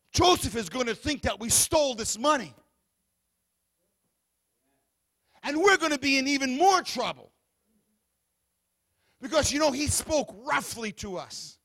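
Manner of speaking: loud, rising sermon build; preaching at full force. Acted. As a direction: drive the fear home to the congregation.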